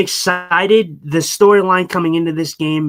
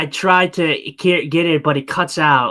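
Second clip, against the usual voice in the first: ordinary delivery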